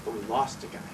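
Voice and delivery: low, soft, resigned; hushed, low admission; subdued regret. Acted. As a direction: drop it quietly, weighed by the loss. Comes back to the loss.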